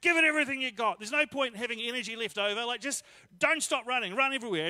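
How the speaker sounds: dad yelling high and loud to his son on the pitch; urgent parental yell from the sideline. Retold or imitated.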